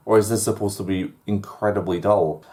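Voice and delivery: ordinary delivery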